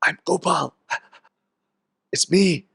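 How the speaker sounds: a dog insisting it's Gopal. Retold or imitated. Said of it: "starts barking".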